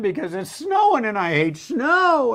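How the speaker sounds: high-pitched mock wail; mock crying. Person crying because they hate snow, hammed-up wailing. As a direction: complain in an exaggerated high cry. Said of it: "other people are crying".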